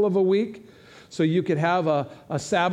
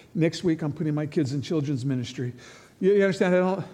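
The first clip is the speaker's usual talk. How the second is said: normal talking voice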